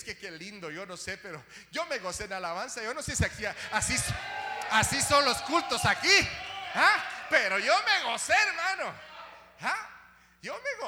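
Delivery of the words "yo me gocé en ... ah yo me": loud, high joyful shouts; preaching at full force. Acted. Preacher rejoicing over the lively services, shouting. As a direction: shout out the joy of praise.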